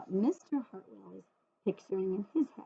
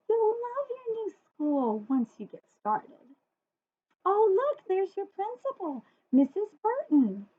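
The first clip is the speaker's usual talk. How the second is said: much higher, bright forced cheer; 10 semitones higher, 3 dB louder than usual. Acted. Father cheering up nervous Sarah, high forced cheer. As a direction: sell it too hard.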